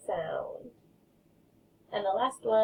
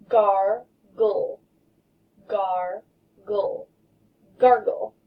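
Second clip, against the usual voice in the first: syllable chopping; 5 dB louder, much slower than usual. Shown as a demonstration. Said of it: "get your choppers ready".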